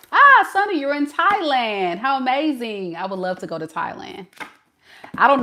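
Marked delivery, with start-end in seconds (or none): 0.1-2.9 much higher and louder, delighted hello; light up as you greet the viewer; excited greeting. Real, not acted.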